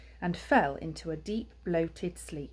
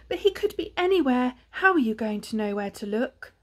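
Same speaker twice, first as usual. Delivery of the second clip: fretting mother hedgehog; 6 semitones higher, 5 dB louder than usual. A narrator's reading. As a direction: fret at him, starting high and loud. Ma frets at Pa.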